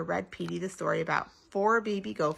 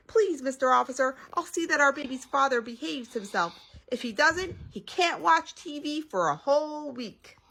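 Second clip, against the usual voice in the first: high-pitched earnest appeal; high, coaxing promise; pleading Mommy; 7 semitones higher than usual. A narrator's reading. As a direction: plead with the officer in a raised voice.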